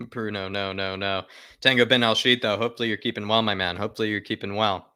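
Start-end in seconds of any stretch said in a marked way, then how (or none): none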